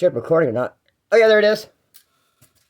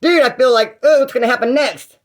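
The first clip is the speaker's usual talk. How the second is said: higher, louder nervous rush; mock panic; 7 semitones higher, 5 dB louder than usual. Thief hiding stolen keys frets to himself, mock panicky. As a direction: sell the jittery dread of getting caught. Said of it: "sweating like a snitch at a gangster party".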